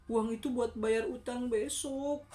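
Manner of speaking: high, slow lament of a worried mother; mother in distress. A narrator's reading. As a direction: draw it out loudly, voicing the troubled mother. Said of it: "kata ibunya".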